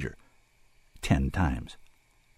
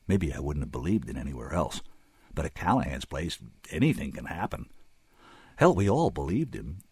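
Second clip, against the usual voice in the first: usual speaking voice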